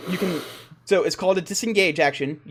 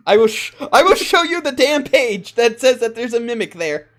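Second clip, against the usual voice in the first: loud, raised-pitch, half-laughing insistence; emphatic protest; 6 semitones higher, 7 dB louder than usual. Real, not acted. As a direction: protest loudly that you are not cheating. Game master insists on the rules.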